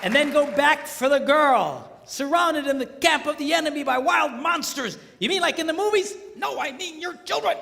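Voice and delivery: high and loud preaching; fiery preaching, loud and high; preaching at full force. Real, not acted. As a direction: keep the fire going.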